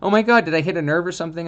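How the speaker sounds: high, loud panicked blurt; hammed-up panic on the phone to the vet. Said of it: "I called the vet in a panic".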